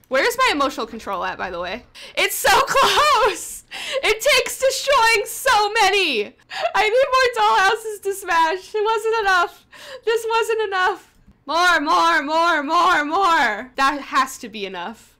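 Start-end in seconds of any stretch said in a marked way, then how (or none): 2.2-13.7 high, loud, slow half-laughing wail; frantic laughing despair. Real, not acted. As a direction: beg for more, laughing and desperate. Desperate laughing frenzy.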